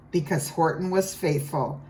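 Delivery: ordinary delivery, nothing marked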